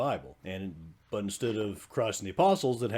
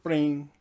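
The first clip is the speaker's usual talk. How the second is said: high, louder imitated ringtone ping; imitated phone alert; 6 semitones higher, 5 dB louder than usual. Storyteller mimics the phone's Twitter alert, imitated ping. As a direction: mimic a phone notification going off.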